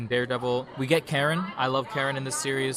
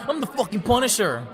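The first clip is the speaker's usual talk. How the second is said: hammed-up Punisher; 8 semitones higher than usual. Punisher bursting into a daycare, hammed-up bragging yell. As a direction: burst in bragging like a cartoon vigilante. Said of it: "He busts into the daycare".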